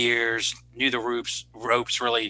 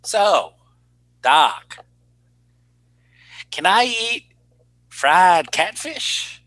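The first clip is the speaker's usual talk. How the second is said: higher, drawn-out Southern drawl on fried catfish; Southern accent; 5 semitones higher, 4 dB louder, much slower than usual. Retold or imitated. Doctor mimics a rural Georgia patient asking about catfish, in a Southern accent. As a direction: play a rural Southern patient asking the doc, slow and sly. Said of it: "my best Southern, Southern impression here".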